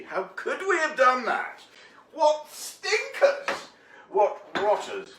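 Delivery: high-pitched mock-indignant exclaiming; mock outrage. Cook jokingly condemning the English over tea duty, mock outrage. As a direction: play scandalised, voice shooting up.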